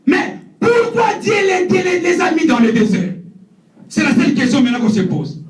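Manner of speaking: sermon delivered with fervour; prédication. Acted. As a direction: preach it, rising and full-voiced. (prêche ardent à l'assemblée)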